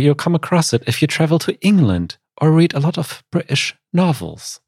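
light attempt at a British accent; lightly put-on British English accent. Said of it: "Was that your British accent?".

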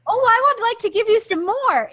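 high, delighted parent voice; doting parent. Retold or imitated. Parent charmed by a grateful child, high and gushing. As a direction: gush like a parent won over by thanks.

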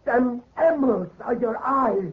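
high, trembling-edged horror; terrified realization. Acted. Groom realizing his bride's eyes are the emeralds, terrified. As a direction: stare in disbelief and dread.

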